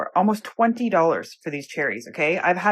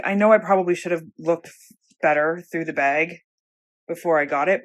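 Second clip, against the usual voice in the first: ordinary delivery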